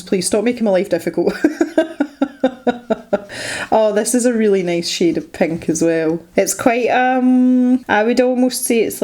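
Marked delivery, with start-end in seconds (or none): 1.4-3.7 giggling laugh. Real, not acted. Laughing after joke.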